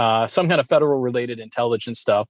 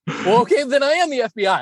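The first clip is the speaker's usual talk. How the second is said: higher, lightly voiced fed giving in; mock surrender, pitched up; imitating the agent; 10 semitones higher, faster than usual. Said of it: "then he's like".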